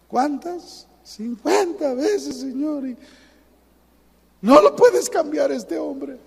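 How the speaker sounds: high, slow drawn-out whine; complaining believer answers God, mock whining. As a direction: sulk and drag the words out. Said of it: "ya estamos llorando".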